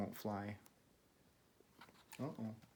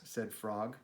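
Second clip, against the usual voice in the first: ordinary delivery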